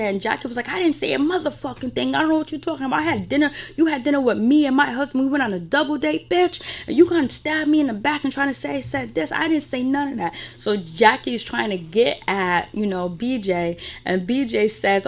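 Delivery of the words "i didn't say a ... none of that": high-pitched retold outburst; mock indignant rant. Acting out Jackie arguing with B J, mock indignant. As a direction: imitate an indignant denial, pitched well up. Acts out Jackie's angry denial.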